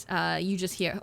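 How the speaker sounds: ordinary talk, nothing marked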